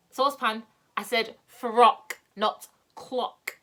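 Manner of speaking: frock said slow and loud against clock; correcting stress. Acted. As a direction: lean on "frock", soften "clock". Beth corrects Saucepan's mishearing.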